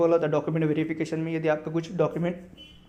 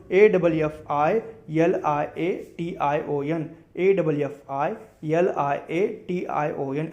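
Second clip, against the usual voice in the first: slow spelling; much slower than usual. Shown as a demonstration. Teacher spells affected to students, slowly letter by letter. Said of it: "next word है affiliation".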